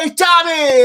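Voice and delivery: high, loud shout across a field; calling out. Retold or imitated. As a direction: yell his name to get his attention.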